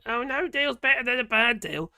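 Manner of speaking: high, loud sneering parody of politicians; mocking quote. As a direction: mimic the slogan with scorn. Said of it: "they were going on, didn't they".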